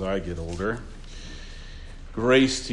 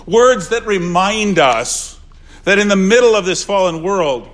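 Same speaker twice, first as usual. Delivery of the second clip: louder, raised pulpit voice; swelling sermon delivery; preaching; 8 semitones higher, 9 dB louder than usual. Acted. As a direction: proclaim comfort to the grieving. Preaching at full force.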